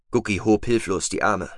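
usual speaking voice

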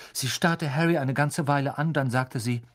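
ordinary delivery, nothing marked

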